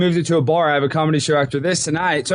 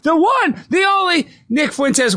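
booming, much higher circus announcer; ringmaster. Acted. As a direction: shout it out like a big-top ringmaster. Hypes guest like circus intro.

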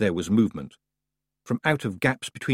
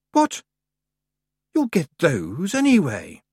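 surprised; 6 semitones higher, 3 dB louder than usual. Acted. Summer Lady startled reply.

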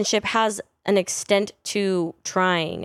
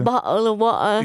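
mock English accent on a stock phrase; 3 semitones higher, 3 dB louder than usual. Shown as a demonstration. Echoing the co-host's attempt, put-on English accent. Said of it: "in an English accent".

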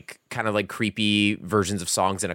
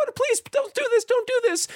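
very high squeaky begging; tiny victims pleading. Retold or imitated. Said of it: "they're like".

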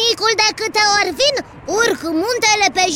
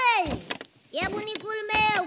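usual speaking voice

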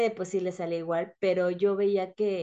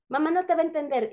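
put-on quick, higher insistent child voice; son's mock protest; 7 semitones higher, faster than usual. Son telling his mother she won't be understood, fast and high-pitched. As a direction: rattle it off like a kid correcting mom. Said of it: "y Aldo".

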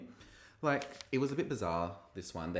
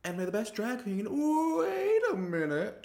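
high show voice breaking into a drawn-out, louder interruption; host impression; 13 semitones higher, 4 dB louder than usual. Host interrupting her own send-off, high mock surprise. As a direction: build to a hammy, stretched high halt. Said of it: "she goes".

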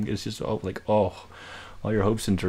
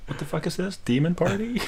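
incredulous; 9 semitones higher than usual. Real, not acted. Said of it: "This is".

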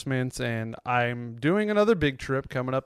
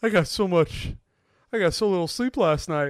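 whiny exhausted groan, pitched up; tired night owl; 6 semitones higher, 3 dB louder than usual. Retold or imitated. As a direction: moan about no sleep. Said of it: "how night owls expect you to react".

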